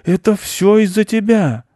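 high, loud blaming yell; furious high-voiced accusation; angry shouting. A narrator's reading. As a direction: shout the blame across at the culprit. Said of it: "кричал он оттуда мышу".

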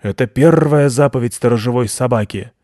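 loud, emphatic, growled R; earnest doggy conviction; convinced guard dog. A narrator's reading. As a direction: proclaim your rule with certainty. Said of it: "убеждённо ответил Робик".

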